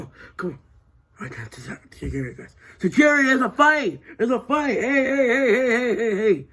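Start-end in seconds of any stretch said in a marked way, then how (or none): 2.8-4.8 high raised alarm call; shouting for security. Acted. Calling guards to a brawl. 4.8-6.5 high, strained guard voice; guard yelling hey. Acted. Guard breaking up a fight.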